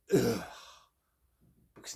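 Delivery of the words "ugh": disgusted groan. Retold or imitated. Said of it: "all your friends have gone".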